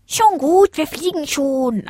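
high, sing-out reply; placating little rival. A narrator's reading. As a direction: give in with a high, drawn-out call. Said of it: "antwortete Zack".

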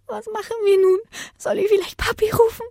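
hohe, weinerliche Mädchenstimme; zitternde Stimme. A narrator's reading. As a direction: frag voller Angst, den Tränen nah. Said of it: "mit zitternder Stimme". (ängstlich zitterndes Mädchen)

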